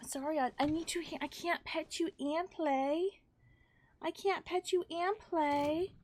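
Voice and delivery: pet voice. Real, not acted. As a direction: plead with the cat. Telling the cat off gently.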